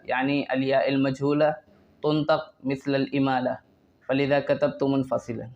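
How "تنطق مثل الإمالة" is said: normal talking voice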